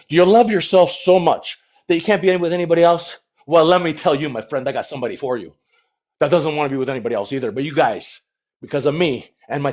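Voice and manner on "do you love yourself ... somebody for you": commercial pitch. Pretend. Host pitching matchmaking to viewers as a commercial. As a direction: sell it like a TV commercial. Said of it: "Does that sound like a commercial?".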